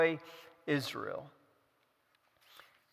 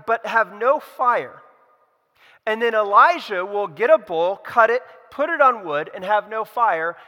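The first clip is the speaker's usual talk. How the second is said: louder, higher preaching build; rising preaching; 5 semitones higher, 6 dB louder than usual. Acted. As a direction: press the story forward like a preacher. Preacher retells the duel.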